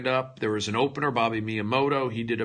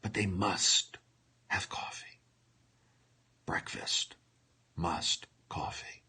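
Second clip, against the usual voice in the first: fading into a breathless whisper; mock tearful whisper; 6 semitones lower, 10 dB quieter than usual. Comedian milking his emotional coffee scene, whispering. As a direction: let the words die away in fake grief. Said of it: "you're doing an emotional scene".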